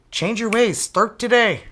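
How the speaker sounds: pitchy ad-man delivery; infomercial voice. Acted. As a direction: sell it like a TV commercial. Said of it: "I sound like an infomercial".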